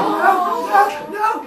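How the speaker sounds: high crying refusal, laughing through it; little brother curled up crying, refusing to go in, high whimper. Retold or imitated.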